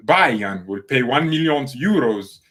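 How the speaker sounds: put-on slow, loud Italian accent; drawn-out, emphatic Italian-accented delivery; Fabrizio. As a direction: announce it in an exaggerated Italian accent. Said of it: "That's how Fabrizio says it".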